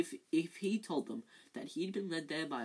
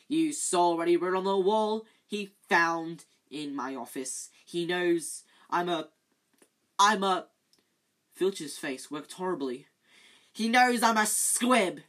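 anguished Filch; 3 semitones higher, 9 dB louder than usual. Acted. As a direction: force out a shameful confession. Filch raging, face working.

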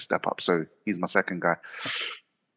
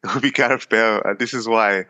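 put-on light playful Welsh accent; 3 semitones higher, 4 dB louder than usual. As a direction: say it with a hint of Welsh. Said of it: "Was that your Welsh accent?".

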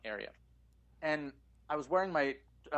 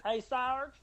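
Gomer Pyle impression; 9 semitones higher than usual. Greeting Sarge in Gomer Pyle voice. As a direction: call out like an eager dim recruit. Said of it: "good impression of Gomer Pyle".

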